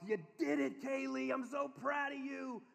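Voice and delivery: high, upbeat cheering; acted proud praise for a small child; encouraging dad.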